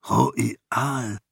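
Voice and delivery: understated disbelief on a lone name; stunned one-word question, falling at the end; disbelieving repeat. A narrator's reading. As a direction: repeat the name as if you can't believe it. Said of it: "fragte Lebensgeist ein weiteres Mal".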